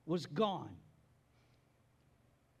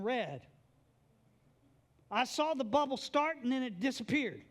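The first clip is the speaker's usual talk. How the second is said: high, pressed complaint to a friend who let her down; hurt, angry complaint; 7 semitones higher than usual. Acted. Martha confronting Jesus, hurt and angry, voice high. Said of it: "she's like".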